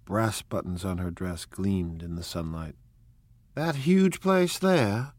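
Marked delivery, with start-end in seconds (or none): 3.6-5.1 high, slow, wondering exclamation; Daisy marvelling aloud at the huge house; excited cry. Acted. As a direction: let Daisy's voice soar up in delight. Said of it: "she cried".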